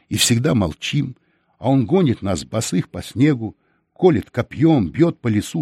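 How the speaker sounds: ordinary delivery, nothing marked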